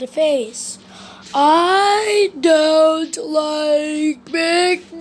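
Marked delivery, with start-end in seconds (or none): 1.3-5.0 very slow, drawn-out grumbling; Mister Slow complaining to Mister Busy, drawn out; slow complaint. Acted. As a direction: whine it at a crawl. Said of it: "he complained".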